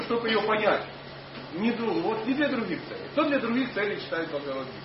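normal talking voice

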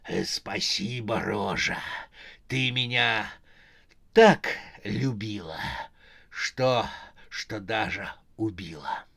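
low, halting corpse voice sinking to a whisper; slow, pause-laden thanks from the grave; dead husband. Acted. (голос мертвеца, низкий, с переходом в шёпот)

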